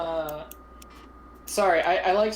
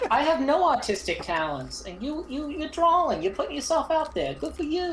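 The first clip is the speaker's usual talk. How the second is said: high mock encouragement; 10 semitones higher than usual. Praising a friend's drawings.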